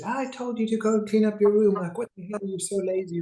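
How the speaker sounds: exasperated parent scolding; angry parent yelling. Retold or imitated. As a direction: play the fed-up parent telling off a lazy kid. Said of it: "starts yelling at them".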